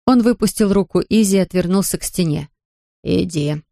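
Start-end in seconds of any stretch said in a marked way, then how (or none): 3.0-3.6 put-on stern old man. A narrator's reading. Grandfather sends her away.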